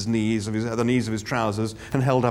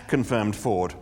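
plain reading voice

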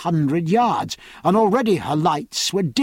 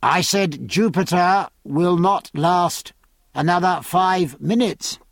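plain reading voice

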